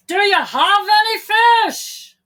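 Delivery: calling from shore. A narrator's reading. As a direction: call out from far away.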